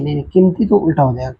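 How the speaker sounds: usual speaking voice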